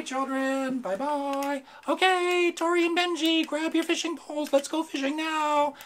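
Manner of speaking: very high, loud squeaky puppet. Acted. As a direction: shout goodbye as the puppet. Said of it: "high-pitched voice".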